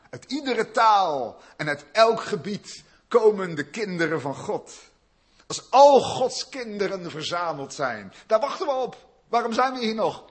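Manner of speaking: loud, high, driving sermon voice; preaching at full force. Acted. Pastor stirring up the congregation, full-force preaching.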